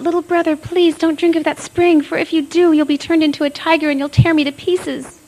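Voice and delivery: high, loud frightened plea; crying out. A narrator's reading. Little sister cries out to stop brother drinking, high and loud. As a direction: cry out to stop him. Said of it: "And she cried out".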